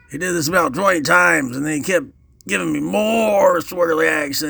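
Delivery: normal talking voice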